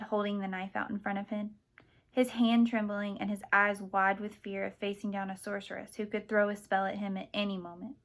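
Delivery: usual speaking voice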